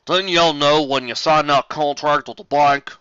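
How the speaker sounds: loud, slow drawled accusation; accusing Big Mac. Acted. Big Mac accusing Applejack over contract, loud slow voice. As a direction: lean into the country drawl.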